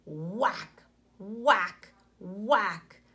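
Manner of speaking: punchy, mock axe blows; chopping sounds. A narrator's reading. Teacher voicing the axe for her class, punchy mock chops. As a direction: thump out each whack like an axe.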